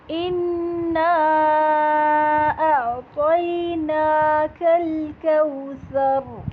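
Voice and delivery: اِنَّا with the doubled noon nasalised and held two counts, slow chant; recited with ghunnah. Shown as a demonstration. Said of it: "ٹو counts کا ایک غنہ". (اِنَّا میں غنہ کے ساتھ تلاوت)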